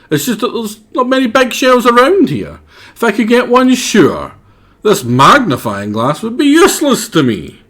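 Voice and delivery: high put-on creature voice; high character voice. Voicing a game character.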